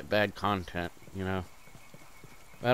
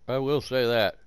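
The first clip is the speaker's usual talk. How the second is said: normal talking voice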